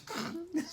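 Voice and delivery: high-pitched burst of giggling; high giggle. Real, not acted. Reviewer cracking up at Glorietta crying. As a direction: squeal with laughter.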